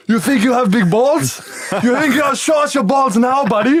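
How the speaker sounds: high-pitched, hammed-up taunting shout; mock angry challenge. Croatian trainer confronts the players in the gym, mock angry challenge.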